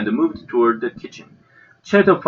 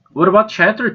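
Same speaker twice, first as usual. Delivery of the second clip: put-on eager child voice; 11 semitones higher, 4 dB louder than usual. A narrator's reading.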